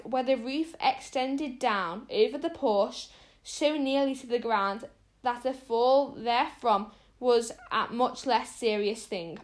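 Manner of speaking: plain reading voice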